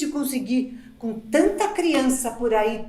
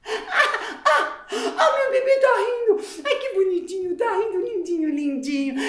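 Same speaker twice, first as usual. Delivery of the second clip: delighted laughing; 5 semitones higher than usual. Real, not acted. Mother delighted her baby is laughing, high laughing coo.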